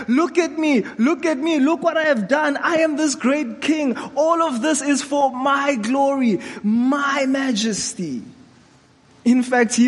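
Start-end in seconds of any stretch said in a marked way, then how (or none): 0.1-0.8 high, proud look at me; boastful king. Retold or imitated. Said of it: "He's just like". 1.0-1.6 high, proud look at me; boastful king. Retold or imitated. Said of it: "He's just like". 1.6-8.2 high, proud boasting king voice; boastful king. Retold or imitated. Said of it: "He's just like".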